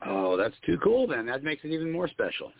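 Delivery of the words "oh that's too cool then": normal talking voice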